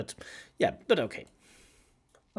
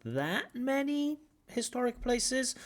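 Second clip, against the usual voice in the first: slow and much higher on "that many historic places"; stretched-out tune; 13 semitones higher, slower than usual. Shown as a demonstration. Examiner models the doubtful stretched intonation, slow and high. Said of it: "The intonation is stretched out".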